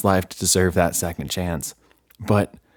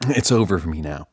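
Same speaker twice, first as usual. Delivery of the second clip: ordinary delivery, nothing marked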